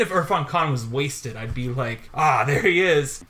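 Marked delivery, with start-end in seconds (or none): 2.1-3.2 louder, drawn-out happy "ah"; delighted greeting, stretched out and louder; excited recognition. Retold or imitated. Film fan spotting a favourite actor pop up, excited. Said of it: "I get a little excited".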